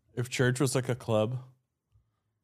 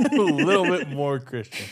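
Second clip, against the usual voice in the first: laughing; 5 semitones higher, 6 dB louder than usual. Real, not acted. Echoing the quote laughing.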